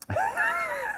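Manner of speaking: a very high, shrieking burst of laughter. Real, not acted. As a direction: let out a wild squeal of a laugh. Hype outburst on stream.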